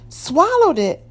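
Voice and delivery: high, loud alarmed cry. A narrator's reading. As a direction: gasp it out in shock. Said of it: "Maria cried".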